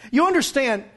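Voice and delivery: ordinary delivery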